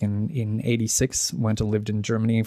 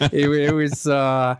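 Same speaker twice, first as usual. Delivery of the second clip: laughing reply; 4 semitones higher, 4 dB louder, slower than usual. Real, not acted. Laughing answer to host.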